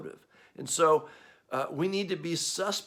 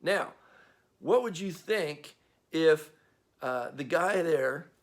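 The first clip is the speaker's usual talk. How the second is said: usual speaking voice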